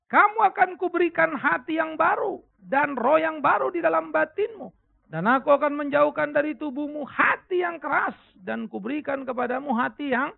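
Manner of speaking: loud, high-pitched Bible verse reading; scripture reading aloud. Real, not acted. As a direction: lead the congregation in reading, loud and measured.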